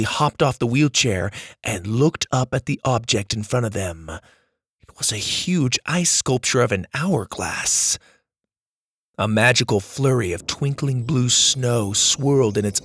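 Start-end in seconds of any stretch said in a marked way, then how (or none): none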